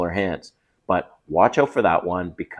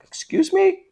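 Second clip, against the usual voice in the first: much higher rising excuse me; indignant disbelief. Retold or imitated. Man to girlfriend who just refused him, indignant rising squeak. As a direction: squeak it out, offended. His reaction to being refused.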